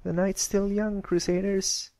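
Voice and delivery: high, keen rallying call; rally the friends; exclaimed. Acted. Scootaloo rallying the Crusaders around the fire, excited. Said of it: "Scootaloo exclaimed".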